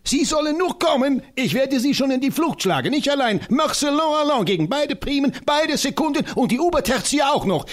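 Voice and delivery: high, fiery voice of the tiny professor; rally for battle with comic zeal; an excitable old scholar, pitched high; eccentric little professor. Acted.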